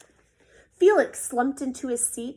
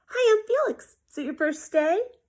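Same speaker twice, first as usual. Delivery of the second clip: much higher and louder; bright friendly greeting; 9 semitones higher, 5 dB louder than usual. Acted. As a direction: welcome the new kid brightly. Felix greeting new boy.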